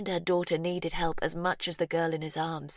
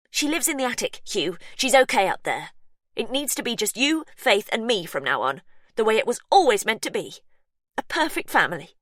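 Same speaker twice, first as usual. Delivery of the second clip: high and louder, urgent and fast; manic pleading; 5 semitones higher, 7 dB louder than usual. Acted. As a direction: sell him the dream breathlessly. Sister pleads her delusion.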